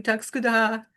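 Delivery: a plain Swedish tack ska du ha; Swedish thank you. Shown as a demonstration. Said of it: "how to say thank you".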